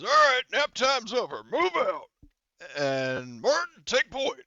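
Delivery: high, loud drill-sergeant style order; Gunny. Acted. Game master as the sergeant rousing the squad, loud barked order. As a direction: call the squad out at full volume. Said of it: "I'm doing Gunny's voice".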